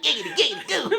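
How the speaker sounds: high giggly catchphrase voice; Quagmire voice. Retold or imitated. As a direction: do the Quagmire giggity.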